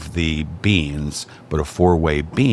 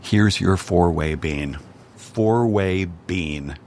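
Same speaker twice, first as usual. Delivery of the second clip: slow drawn-out order of four-way bean; slow playful God voice handing over food; slow, savoring; much slower than usual. Shown as a demonstration. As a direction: savor each word slowly like a treasured order. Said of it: "here's the ridiculous thing".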